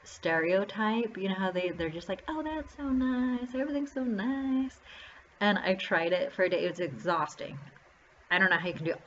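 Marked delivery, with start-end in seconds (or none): 2.3-4.8 Southern Belle. Retold or imitated. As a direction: put on a sweet Southern Belle accent, stretching every word. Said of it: "Southern Belle stereotype".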